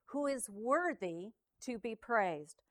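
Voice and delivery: fervent, swelling Bible reading; scripture reading. Acted. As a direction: proclaim the psalm to the congregation. Reads Psalm 18 aloud.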